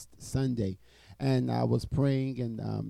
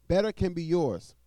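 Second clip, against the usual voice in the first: louder, ringing preacher's promise; emphatic repetition; 6 semitones higher, 8 dB louder than usual. Acted. Preacher urging listeners that better can be theirs, loud and emphatic. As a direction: press the promise home to the listeners. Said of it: "preacher repeats the promise, louder".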